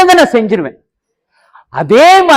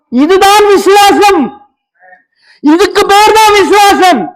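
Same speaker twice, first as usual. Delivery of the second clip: raised, slow, shouted proclamation; proclaiming; 7 semitones higher than usual. Real, not acted. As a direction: declare it to the church. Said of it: "climax declaration of faith". (உரத்த அறிவிப்பு)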